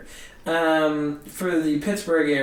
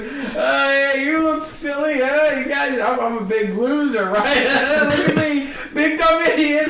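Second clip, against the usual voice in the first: higher, louder mock crowd work; self-sabotaging; 7 semitones higher, 5 dB louder than usual. Comic out of material working the crowd, self-mocking. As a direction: heckle yourself with a laugh creeping in. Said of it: "just sabotaging myself".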